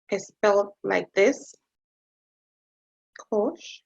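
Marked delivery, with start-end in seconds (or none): 3.3-3.8 "cosh" ending in a sh sound; softer "cosh", closing on sh; cosh as one word. Shown as a demonstration. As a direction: say "cosh" as one syllable, sh at the end. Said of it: "it's pronounced cosh".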